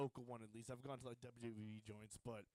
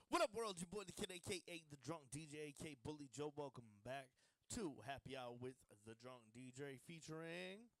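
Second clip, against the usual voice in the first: ring announcer voice. Acted. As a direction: call it out like a ring announcer hyping the crowd. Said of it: "my ring announcer voice".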